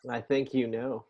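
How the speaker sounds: low, soft, suggestive voice; knowing, softly insinuating; accidentally seductive. Acted. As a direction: say it low and quiet, like unintended flirting. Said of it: "didn't mean for that to sound erotic".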